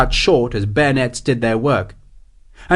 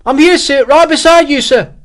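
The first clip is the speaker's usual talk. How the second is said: high, loud junior officer's piping reply; small voice; 14 semitones higher, 7 dB louder than usual. A narrator's reading. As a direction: pipe up urgently from right beside the captain. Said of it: "the small voice said".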